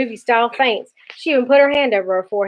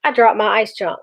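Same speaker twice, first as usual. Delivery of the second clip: ordinary delivery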